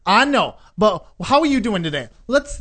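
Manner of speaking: high, loud chatty imitation; outgoing lady. Outgoing lady to the complaining man, hammed-up friendliness. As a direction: gush loudly like a sociable seatmate. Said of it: "this very outgoing lady".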